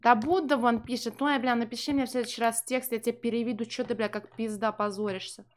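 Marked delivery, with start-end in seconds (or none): none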